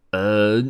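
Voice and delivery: loud "ahem" before speaking; throat clearing. A narrator's reading. Grandpa Storyteller readies the chicks for his spell, clearing his throat. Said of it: "故事爷爷清了清嗓子".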